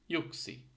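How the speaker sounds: "ইউক্সি" opening like English you; teacher's careful, high "ইউক্সি"; Finnish Y sound. Shown as a demonstration. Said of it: "English ইউর মতো".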